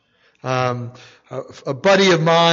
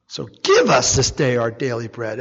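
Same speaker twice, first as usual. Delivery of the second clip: "give" leaned on a little more than the rest; stress on give; 7 semitones lower than usual. Shown as a demonstration. Preacher to congregation, light stress on "give". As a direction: lean on "give" in "give us this day". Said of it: "Emphasis on the word give".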